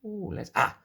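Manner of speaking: plain reading voice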